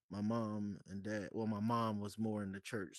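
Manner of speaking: ordinary talk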